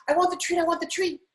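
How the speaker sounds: high, eager mock begging; begging dog. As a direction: plead like a dog after a treat. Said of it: "when a dog is begging".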